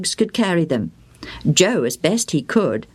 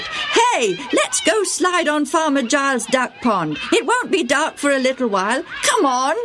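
put-on high boyish voice, urging friends on; lively schoolboy voice; eager boy Bill; 9 semitones higher than usual. A narrator's reading. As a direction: coax the gang toward the pond.

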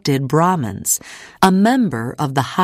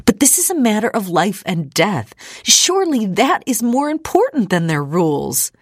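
rising hysteria; 6 semitones higher than usual. Acted. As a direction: pitch climbing, voice growing frantic. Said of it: "hysteria rising in her voice".

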